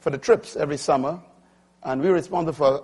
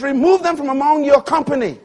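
full-force preaching; 11 semitones higher, 9 dB louder than usual. Acted. Preacher warning the congregation, full-force preaching. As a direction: drive it home from the pulpit.

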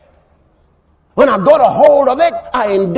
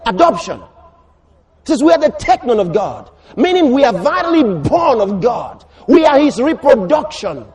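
ordinary talk

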